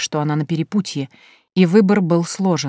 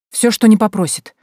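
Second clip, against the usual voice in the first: higher, louder fervent promise; raised, impassioned pledge; eager exclamation; 5 semitones higher, 6 dB louder than usual. A narrator's reading. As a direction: exclaim it like a desperate supplicant. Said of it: "воскликнула она".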